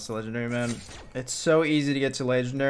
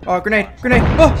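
grenade warning yell; 6 semitones higher, 9 dB louder, faster than usual. Real, not acted. Calling out grenade in game.

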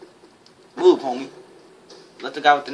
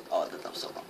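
ordinary talk

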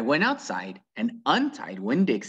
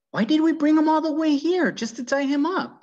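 put-on high, louder protesting child; indignant girl; 5 dB louder than usual. Opal protesting to the preacher, high and loud. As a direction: Opal objecting, voice pitched up high.

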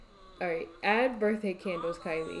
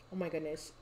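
usual speaking voice

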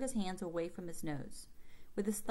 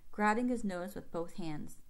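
plain reading voice

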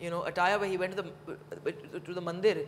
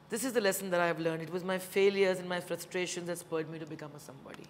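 measured, lower-pitched formal reading; reading a letter aloud; 4 semitones lower than usual. Acted. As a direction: read it out like a prepared address. Reads father's written words aloud.

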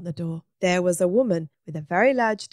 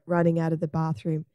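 ordinary delivery

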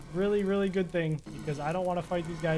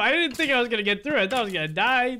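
triumphant excitement; 6 semitones higher, 6 dB louder, faster than usual. Real, not acted. Triumphant after the nether.

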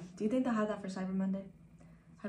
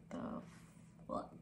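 normal talking voice

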